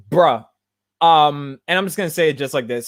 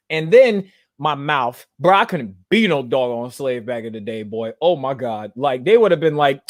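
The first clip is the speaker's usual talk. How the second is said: ordinary delivery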